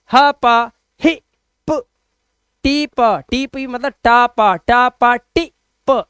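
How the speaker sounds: drawn-out nonsense syllables with short i; slow sound drill. Shown as a demonstration. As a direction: chant them slowly like a phonics drill. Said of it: "sound drill demo". (धीरे धीरे ध्वनि अभ्यास)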